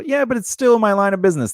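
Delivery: high-pitched arguing retort; retold protest. Speaker answering doubters about the business, protesting.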